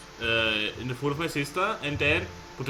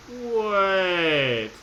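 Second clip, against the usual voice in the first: surprised drawn-out wait; 4 semitones higher than usual. Real, not acted. Player suddenly noticing a clue, drawn out. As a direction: stop yourself mid-thought, surprised.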